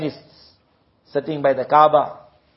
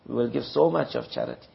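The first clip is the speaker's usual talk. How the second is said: lower, softer repeat; softer echo; 3 semitones lower, 7 dB quieter than usual. Shown as a demonstration. Preacher echoes the hollow promise in a softer voice. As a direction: let the promise fall away quietly.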